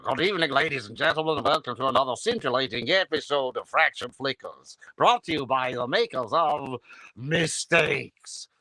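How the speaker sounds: mock-grand presenter with an affected stage accent; Hans Conried. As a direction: grandly announce with plummy relish. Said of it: "I did Hans's voice".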